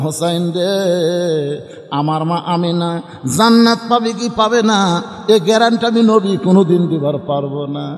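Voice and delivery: chanted, mildly tearful cry; acted tearful lament. Prophet weeping over his mother's fate, mildly tearful chant. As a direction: draw out the call, then plead in a preacher's cadence. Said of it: "রাসূল কান্দের বলে".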